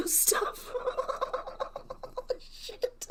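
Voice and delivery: high, wheezy laughing fit; words dissolving into laughter; helpless laughter. Real, not acted. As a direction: lose it laughing, barely getting the word out. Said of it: "Get a hold of yourself".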